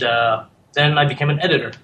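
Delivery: normal talking voice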